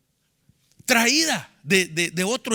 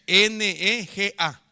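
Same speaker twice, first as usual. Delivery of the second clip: slow, spaced letters, a bit louder; spelled out slowly; 3 dB louder, much slower than usual. Shown as a demonstration. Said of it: "Le faltó el acento en la A". (deletreado despacio, letra por letra)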